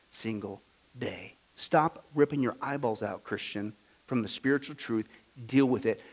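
hushed pastoral lament; soft grave preaching. Acted. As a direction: mourn over the blinded church. Soft grieving sermon passage.

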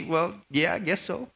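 a dad caught out, unsure of himself; dad answering his daughter, losing confidence. Retold or imitated. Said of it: "not so confidently".

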